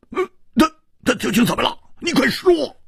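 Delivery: very high, agitated stammer; frantic, high-pitched urging; urgent demand. A narrator's reading. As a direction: press for news in a panic of impatience. Man urgently demands news.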